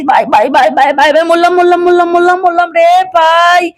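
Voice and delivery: hammed-up cry after a crash; pained wailing. Uncle after falling off the bike, hammed-up pained wail.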